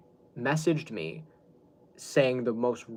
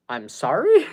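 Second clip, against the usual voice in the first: louder, higher incredulous question, rising at the end; zdumiony retold reaction; 4 semitones higher, 9 dB louder, slower than usual. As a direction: retell it as a taken-aback, disbelieving question. Taken aback by spanker's Jesus answer.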